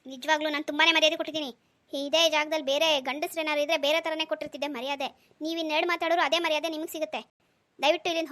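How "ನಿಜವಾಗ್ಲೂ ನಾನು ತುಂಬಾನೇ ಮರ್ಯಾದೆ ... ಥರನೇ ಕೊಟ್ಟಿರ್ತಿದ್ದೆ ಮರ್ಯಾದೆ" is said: ordinary talk, nothing marked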